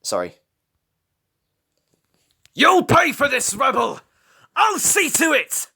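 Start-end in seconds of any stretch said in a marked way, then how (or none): 2.5-5.7 high, loud vengeful threat; threatening shout. A narrator's reading. Prowler retreating from Rebel, loud threatening shout. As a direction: vow revenge as you retreat. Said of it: "he shouted threateningly".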